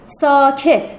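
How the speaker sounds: loud, firm order for silence; loud command. Acted. Girl silencing her chattering classmates, loud command. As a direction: cut through the chatter loudly. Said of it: "شفیقه بلند گفت".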